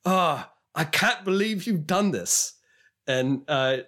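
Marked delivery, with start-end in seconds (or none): 0.1-2.5 higher, slow, aggrieved British vlogger; mock-wounded British accent, stretched out; British accent impression. Vlogger just smacked in the face, wounded British accent. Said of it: "the British guy says".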